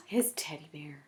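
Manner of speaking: breathy hushed whisper; whispered reveal. Acted. Reader whispers the picture to the children. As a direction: whisper it like a secret.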